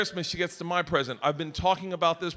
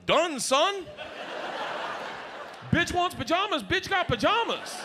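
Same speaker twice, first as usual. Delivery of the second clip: higher, gleeful brag about the wrapped gifts; smug gloating; 10 semitones higher, 4 dB quieter than usual. Retold or imitated. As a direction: crow over the pile of presents. Gloating after wrapping seven gifts.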